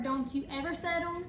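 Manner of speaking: usual speaking voice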